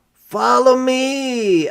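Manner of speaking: high, slow, eager reply; eager boy. Acted. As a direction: answer bright and ready to play. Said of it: "I said".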